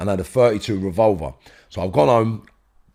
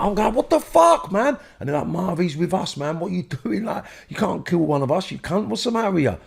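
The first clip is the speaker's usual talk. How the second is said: retold mates' alarm; 9 semitones higher than usual.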